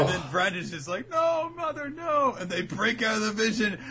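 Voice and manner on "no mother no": high-pitched melodramatic protest; hammed-up cry to a mother; mock anguish. Retold daughter's anguished cry.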